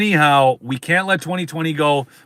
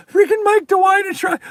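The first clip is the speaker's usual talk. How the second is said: exasperated high outburst; 14 semitones higher, 3 dB louder than usual. Real, not acted.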